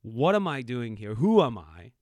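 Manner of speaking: ordinary delivery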